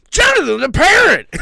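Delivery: high, loud call across the room; mock calling out. Host calling out to the parrot, loud and high. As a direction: shout his name up high.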